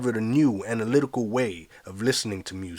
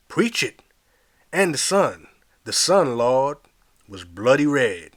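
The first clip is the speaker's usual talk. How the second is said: fervent preacher; 4 dB louder than usual. Acted. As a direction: lift each phrase like a fervent preacher. Call and response sermon.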